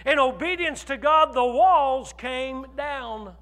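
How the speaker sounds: loud, slow preaching with shouts; fiery sermon proclaiming God's power; preaching at full force. Acted. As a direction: thunder it from the pulpit. Preaching the walls of Jericho.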